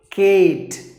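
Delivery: Kate said loud and high as the wrong ending. Retold or imitated. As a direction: hit Kate as the mistake to avoid. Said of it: "Please don't say it as Kate".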